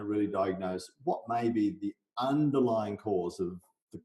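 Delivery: ordinary talk, nothing marked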